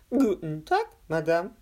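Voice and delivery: loud gurgling, as if mouth full of water. A narrator's reading. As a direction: talk through a mouthful of water. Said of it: "seine Stimme klang glucksend, als hätte er den Mund voller Wasser".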